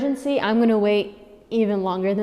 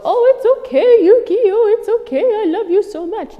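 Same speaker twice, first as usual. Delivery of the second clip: put-on much higher, louder cooing baby talk; doting owner; 12 semitones higher, 9 dB louder than usual. Mocking an owner soothing a barking puppy, high cooing. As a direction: gush over the puppy like a besotted owner. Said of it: "is go".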